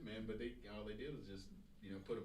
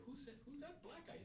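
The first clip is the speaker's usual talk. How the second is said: much higher, questioning quote; high mock coworker voice. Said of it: "they'd say".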